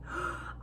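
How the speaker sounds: a quick, soft unvoiced intake of breath; sharp gasp. A narrator's reading. Ron sees the silvery cloak fall, gasping softly. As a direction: gasp in awe at the shimmering cloak. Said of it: "Ron gasped".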